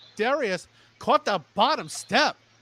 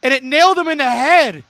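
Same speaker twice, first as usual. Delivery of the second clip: excited call; 4 semitones higher, 10 dB louder than usual. Acted. Calling the big hit.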